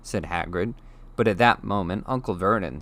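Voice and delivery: plain reading voice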